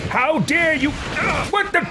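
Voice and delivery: very high, indignant imperious cries; haughty battle leader. Acted. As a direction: order useless servants about at a high pitch.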